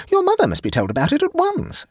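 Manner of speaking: very high, urgent insistence; high-voiced woman demanding help; alarmed. A narrator's reading. Jane alarmed, demands action.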